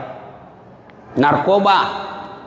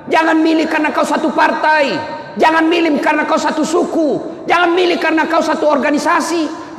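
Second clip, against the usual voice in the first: high-pitched, driving sermon harangue; full-force preaching; 9 semitones higher than usual. Acted. Preacher warns the congregation about choosing leaders, impassioned harangue. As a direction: hammer out each warning like a rally preacher.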